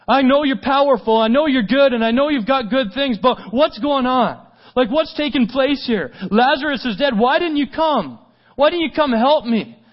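high, loud, urgent reproach; exasperated pleading. Acted. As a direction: plead as Martha demanding to know why. Acts out Martha confronting Jesus.

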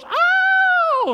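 put-on very high, loud child's squeal; shrill toddler squeal of delight; little girl's squeal. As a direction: squeal like a toddler grabbing a bug. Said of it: "three-year-old girl goes".

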